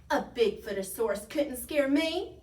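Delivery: loud little-dinosaur bragging; boastful. A narrator's reading. Young dinosaur boasting to friends, loud and bold. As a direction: brag it out boldly.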